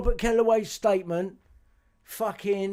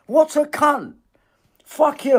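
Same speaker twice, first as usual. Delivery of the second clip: high, loud insult hurled at the lawyer; raging at the lawyer after hearing the call; furious shout; 10 semitones higher, 10 dB louder, slower than usual. Real, not acted. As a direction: spit the words out in rage. Shouting abuse at the recording.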